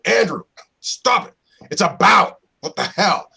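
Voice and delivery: high, loud mock outburst of exasperation; imitated director's scream. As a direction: play an American director yelling at an actor. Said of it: "American directors scream at me".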